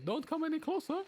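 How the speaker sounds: very high cockney warning; cockney robber warning. Acted. A robber warning hostages in a high cockney voice. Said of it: "the only thing you say".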